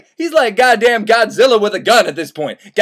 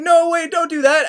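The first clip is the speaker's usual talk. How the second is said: high, hasty no; lightly voiced woman refusing the governor; mock alarmed refusal; 8 semitones higher than usual. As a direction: squeak out a mock protest. Cunegonde refusing.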